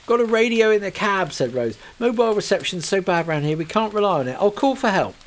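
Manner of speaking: calm in a crisis; Rose voiced, take-charge. Rose tells Kate she will radio for help, urgently.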